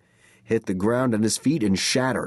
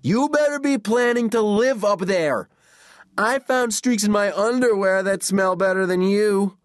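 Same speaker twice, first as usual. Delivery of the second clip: high-pitched, raised-voice insults; taunting; 12 semitones higher, 4 dB louder than usual. A narrator's reading. Kid up a tree insulting the brother below, taunting. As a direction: jeer down at him, pitched high. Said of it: "the name was taunt enough".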